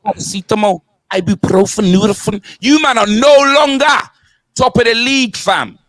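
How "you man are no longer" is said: normal talking voice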